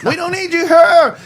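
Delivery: high, loud mock cry with "here" as "her"; high mocking imitation, "here" said like "her"; hammed-up "her" mimicry. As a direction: wail the line, stretching "here" into "herrr". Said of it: "No, her".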